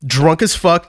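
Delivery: usual speaking voice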